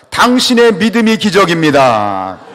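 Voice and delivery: booming, drawn-out preacher's shout; loud, stretched rallying blessing; wholehearted proclamation. Shown as a demonstration. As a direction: proclaim it with full conviction, slowly and high. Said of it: "진심을 담아가지고".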